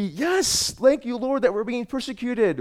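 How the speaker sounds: high-pitched jubilant cry of thanks; mock-rejoice as if overjoyed to suffer; celebrating. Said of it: "It means to celebrate".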